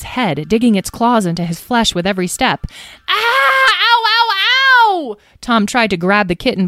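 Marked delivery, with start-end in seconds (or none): 3.1-5.2 high, loud, drawn-out howls of pain; slow wailing ows; screaming in pain. A narrator's reading. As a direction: yowl as claws dig into your scalp. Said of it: "the screaming and the grabbing".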